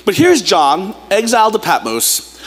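ordinary talk, nothing marked

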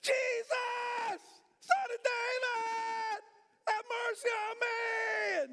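very high, stretched-out scream. Acted. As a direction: keep screaming for mercy, refusing to be hushed. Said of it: "He got louder".

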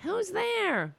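put-on high-pitched, drawn-out old lady call. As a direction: imitate an elderly neighbor calling into the hall. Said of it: "the old lady would be like".

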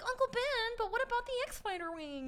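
very high-pitched mock whine; hammed-up whining, held high all through; whiny Luke. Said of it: "when he's whining the first scene".

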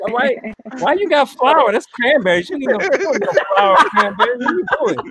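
high, scolding and exasperated. Acted. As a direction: scold her for grabbing flour. Voicing someone messing up cranberry.

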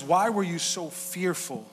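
slow, drawn-out question; slow reading of scripture. Acted. Pastor reading Jesus' question to the disciples, slow. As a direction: let each word land slowly. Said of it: "It says".

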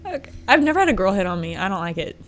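normal talking voice

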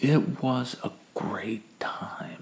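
slow, very soft whisper; whispered reminiscence. Acted. Storyteller looks back on a great afternoon, whispering slowly. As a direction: whisper it, drawing out the words.

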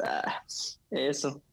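ordinary talk

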